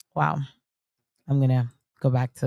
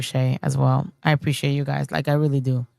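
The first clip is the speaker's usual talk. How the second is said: ordinary delivery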